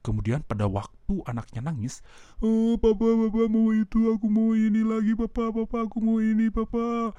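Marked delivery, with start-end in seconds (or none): 2.4-7.1 put-on high, pleading little kid voice; whining child. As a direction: whine for papa like a spoiled toddler.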